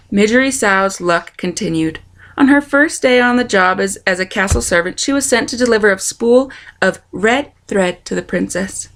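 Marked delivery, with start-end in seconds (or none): none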